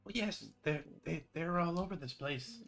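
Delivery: high pleading prisoner voice; small, high and eager; Droop's voice. Acted. Freed prisoner introduces himself to his rescuer, high-pitched.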